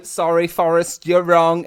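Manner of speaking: lively holiday-camp host; holiday rep. Pretend. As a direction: work the crowd like a holiday rep. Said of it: "you sound like you're just holiday repping".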